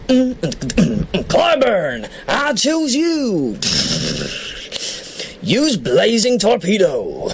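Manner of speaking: mock battle command; Pokemon trainer. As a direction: call out the attack like an anime trainer.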